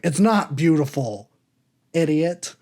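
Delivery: a hint of a charming British accent. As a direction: lightly imitate a British man's scolding. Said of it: "he says it in a British accent".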